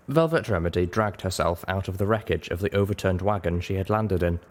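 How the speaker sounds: usual speaking voice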